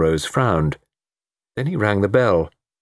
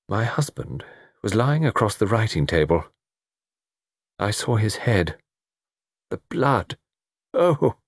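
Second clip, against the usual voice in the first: horrified recollection; 3 semitones higher than usual. Acted. Laura recalls finding the body.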